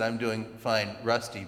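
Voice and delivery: low, quiet, unenthused answer; just how she actually said it, dull; her real flat reply. Retold or imitated. The classmate answering Rusty in the hall, a low flat reply. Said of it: "She said".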